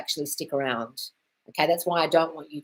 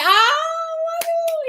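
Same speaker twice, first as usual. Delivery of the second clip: delighted squeal; 7 dB louder than usual. Real, not acted. Delighted squeal at success story.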